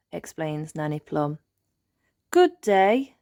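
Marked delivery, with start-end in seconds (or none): 2.3-3.2 loud, high hearty greeting; shout. Acted. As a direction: call out the hello across the forest. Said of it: "shouts the wise old elf".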